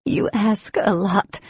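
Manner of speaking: hoarse. Acted. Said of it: "she said hoarsely".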